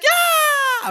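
high shrieking yeah; excited shout. Retold or imitated. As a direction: shriek it in pure joy. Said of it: "I'm like".

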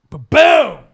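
a punchy, loud ba-boom sound effect; loud mock ba-boom. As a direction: slam it out like an explosion. Imitated explosion sound in story.

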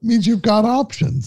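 high, hammed-up salesman pitch; mock pitchman voice. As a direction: sell it like a cheesy pitchman.

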